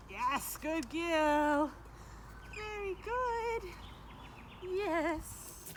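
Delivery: high, drawn-out praise for the dog. Real, not acted. Praising dog after trick.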